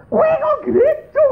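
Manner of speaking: high, loud mock disbelief; incredulous outcry. Spouses arguing about going to church, acted incredulous outcry. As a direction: exclaim it as an astonished challenge. Acted husband-wife quarrel.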